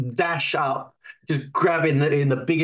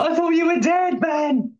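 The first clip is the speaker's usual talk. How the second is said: relieved shout; 13 semitones higher, 4 dB louder than usual. Acted.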